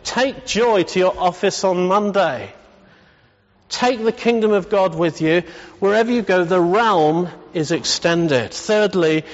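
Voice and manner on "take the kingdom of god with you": normal talking voice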